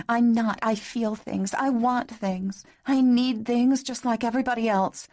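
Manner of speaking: high, rising outburst; distressed protest. A narrator's reading. Woman defends her feelings to her lover, distressed.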